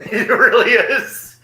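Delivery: squealed high and loud through laughter, slowly; laughing. Real, not acted. Agreeing with a friend about junk food, laughing loudly.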